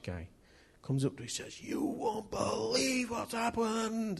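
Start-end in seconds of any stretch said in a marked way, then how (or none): 1.6-4.2 high, drawn-out Scottish voice; Scottish accent. Acted. Older Scottish man bringing news to the preachers, Scottish accent. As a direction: play an amazed old Scotsman, slow and high. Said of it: "This is my Scottish accent now".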